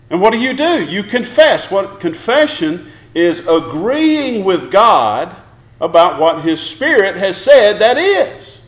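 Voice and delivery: normal talking voice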